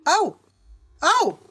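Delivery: high, startled little exclamations; shocked reaction. Real, not acted. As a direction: let the alarming news land, pitched high. Reacts to alarming story detail.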